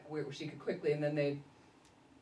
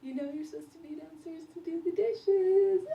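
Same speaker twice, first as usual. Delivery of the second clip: playful teasing; 11 semitones higher, 7 dB louder than usual. Retold or imitated. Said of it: "I just said".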